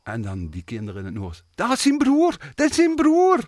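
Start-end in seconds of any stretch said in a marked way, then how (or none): 1.6-3.4 excited high-pitched shout; much higher and louder, calling out a discovery; children calling out. Retold or imitated. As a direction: shout it out like amazed kids in a theatre. Acting out children in the audience.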